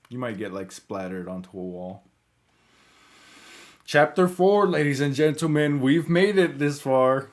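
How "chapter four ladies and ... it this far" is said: grand announcement. Real, not acted. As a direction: announce it like a ringmaster. Announcing new chapter to viewers.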